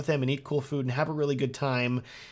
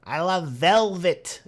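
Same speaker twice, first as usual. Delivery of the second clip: slow, raised sitcom declaration; George Costanza; 5 semitones higher, 4 dB louder, much slower than usual. Acted. Quoting a Seinfeld scene about velvet, hammed-up sitcom impression. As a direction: savour every word, drawn out and higher. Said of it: "George is like".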